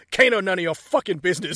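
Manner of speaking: loud, snarling brush-off; mock gruff Kano. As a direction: snarl it like a rude thug. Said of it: "he goes".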